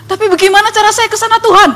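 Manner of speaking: high, fast, pleading shout; shouting in the street. Retold or imitated. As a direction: call up to God urgently for directions. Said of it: "Teriak-teriak di jalan gitu".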